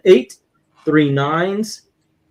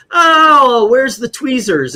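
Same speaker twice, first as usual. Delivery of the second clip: hurt monster; 8 semitones higher, 5 dB louder, slower than usual. Retold or imitated. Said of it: "He goes".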